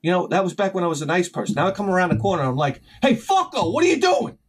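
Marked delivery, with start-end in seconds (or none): 3.0-4.4 high, punchy challenge; aggressive confrontation. Retold or imitated. Worker catches guy in his tool area, aggressive high challenge. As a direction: confront him with a raised, high-pitched voice. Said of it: "maybe I don't say it like that".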